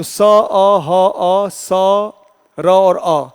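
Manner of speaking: very slow, each sound separated; letter by letter. Shown as a demonstration. Teacher to class, dictating the letters of the word slowly. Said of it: "इस तरह से यदि वर्णच्छेद करते हैं".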